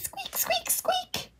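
very high, soft squeaky whisper; high whispered squeak. Pretend. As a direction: squeak it out thin and breathy like a wheel. Wheelbarrow squeak sound.

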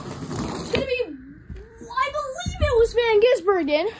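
high, stretched-out race call; excited, unsure call. Real, not acted. Commentator unsure who crossed first, excited. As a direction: draw out the call as you work out the winner.

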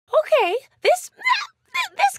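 high, stuttering start; flustered stammer. Acted. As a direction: trip over the words, pitch shooting up. Game character stumbles over reply.

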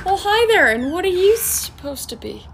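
high, loud, gushing hello; excited greeting. Acted. Neighbour greeting a trick-or-treater, gushing. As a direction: greet the kid with big cheer.

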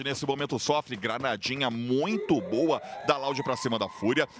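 normal talking voice

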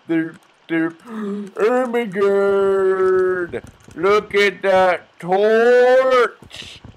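put-on high, loud goofy drunk with dragged-out words; hammed-up drunken dimwit, high-pitched; drunk NPC. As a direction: play a dazed, derpy game character, stretching vowels. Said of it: "my impersonation of a drunk N P C".

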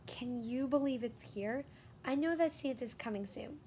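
usual speaking voice

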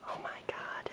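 soft whispered gasp; whispering. Real, not acted. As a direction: breathe it out in a whisper. Late at night in hallway.

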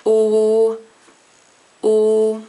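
O said as a pure rounded o; O, pure and held, no glide; O demonstrated for learners; Swiss German O. Shown as a demonstration. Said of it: "which is just pronounced as an O".

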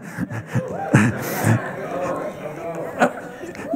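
a brief real laugh, mostly breath; short laugh. Real, not acted. Preacher chuckling at his own point, real laugh. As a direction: let a quick chuckle slip out.

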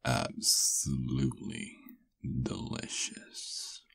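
slow, soft murmur sinking to a whisper; savoring. Acted. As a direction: draw it out softly, relishing the taste. Savoring the taste afterwards.

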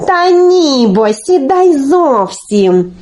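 insistent correction. Acted. Olenka corrects Telesyk on the shovel, drawn-out and insistent. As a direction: draw out the objection with impatience.

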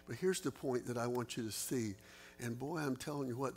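ordinary delivery

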